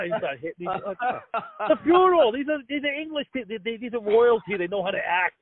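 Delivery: ordinary talk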